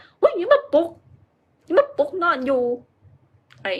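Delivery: high, whiny just-woken protest; grumpy half-asleep. Retold or imitated. Someone woken from an evening nap, grumbling at whoever speaks. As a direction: whine like someone dragged out of a nap. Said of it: "ผู้ใดเว้านำก็บอก".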